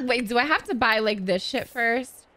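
ordinary talk

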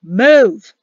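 sudden loud one-word order; forceful officer's bark; barked command. A narrator's reading. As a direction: shout it to get them running.

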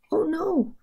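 high-pitched storybook "oh no"; dismayed cry. A narrator's reading. Giant George finds the shop closed, a dismayed cry. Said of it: "cried George".

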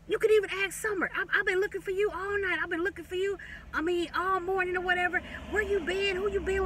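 high, quick whiny excuse voice; mocking boyfriend. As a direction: mimic his high innocent excuses. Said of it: "And he was like".

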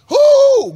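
high, loud "woo"; exultant pulpit whoop; excited whoop. Real, not acted. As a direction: let out a sudden praise whoop. Preacher whoops mid-sermon.

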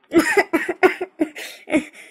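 embarrassed giggle; high laugh. Real, not acted. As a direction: laugh at yourself. Laughs at own breakdown.

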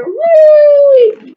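high, loud ringing call. Acted. Reader imitates the phone ringing, high and loud. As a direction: belt it out like a phone bell.

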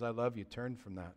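soft, low, tender plea; gentle. Acted. Jesus correcting someone who has fallen, gently. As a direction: speak it gently, almost under your breath. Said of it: "comes to us with gentleness".

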